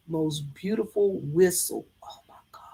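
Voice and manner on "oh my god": breathless whisper of wonder; hushed, reverent oh my God; awed whisper. Real, not acted. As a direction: whisper it, overcome by the beauty. Awed by his whistling.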